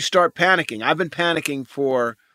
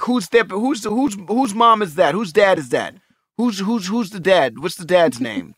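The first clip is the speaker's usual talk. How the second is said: protective demand; 5 semitones higher than usual. Retold or imitated. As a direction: demand names like an angry dad. Protective dad retelling outburst.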